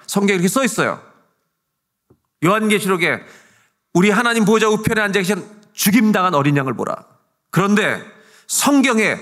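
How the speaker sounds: high, loud scriptural proclamation; full-force preaching. Acted. Pastor proclaims scripture to the congregation, full-force preaching.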